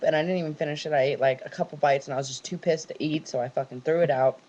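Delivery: ordinary delivery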